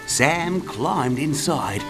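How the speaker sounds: ordinary delivery, nothing marked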